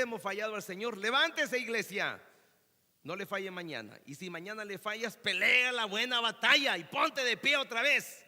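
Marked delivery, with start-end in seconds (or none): 1.0-2.2 loud, raised rallying call; rousing command. Real, not acted. Preacher rallies a church that has failed, loud command. 5.2-8.2 shouted, high rallying cry; rousing exhortation. Real, not acted. Preacher urges the fallen to fight on, shouting.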